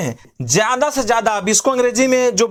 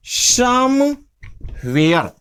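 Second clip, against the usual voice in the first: drawn-out slow somewhere; slow teaching model; 3 semitones higher, much slower than usual. Shown as a demonstration. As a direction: stretch "somewhere" out slowly for learners. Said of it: "तो कहीं न कहीं क्या बोल देंगे?". (धीरे-धीरे खींचकर "somewhere")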